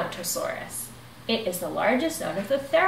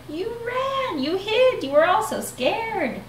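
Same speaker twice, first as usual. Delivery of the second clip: teasing; 11 semitones higher than usual. Acted. Bonehead teasing friends.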